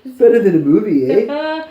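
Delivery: high, loud mock-Canadian heckle; Canadian onlooker. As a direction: play up the rising Canadian "eh". Joins in as watching Canadians.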